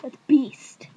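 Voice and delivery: loud, rough exclamation; big hammed-up outburst; mock hype. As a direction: blast it out as playful slang. Exclaiming over toenail flower.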